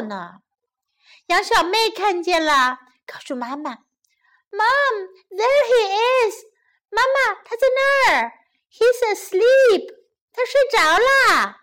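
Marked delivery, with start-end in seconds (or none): 4.5-11.6 high-pitched little lamb voice; high, urgent childlike calling. A narrator's reading. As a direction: pipe up like a small child spotting something. Little lamb tells mother.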